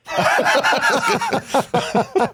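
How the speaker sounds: squealing laugh; real laughter; high laugh burst. Real, not acted. Big laugh at punchline.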